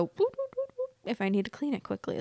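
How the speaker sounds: quick high, soft whoops; light imitation of a swift scrub; whooping scrub noise. Vlogger showing how quickly she cleans the toilet, high little whoops.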